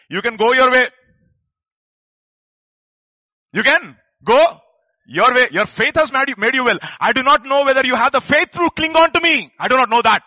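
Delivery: loud, high, fervent voice of Jesus; Jesus voiced at full force. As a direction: proclaim it as Jesus sending him off. Jesus voiced, preaching climax.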